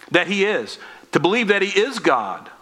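usual speaking voice

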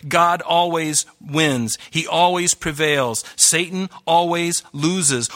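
slow, every word set down; slow emphatic declaration. Real, not acted. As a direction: hammer each short claim slowly.

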